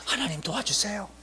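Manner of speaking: soft, hushed prayer; quiet plea for help. Retold or imitated. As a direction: ask God for help in a small voice. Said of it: "도와달라고". (작은 목소리로 드리는 기도)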